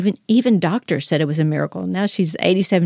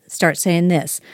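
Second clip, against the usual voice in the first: ordinary talk, nothing marked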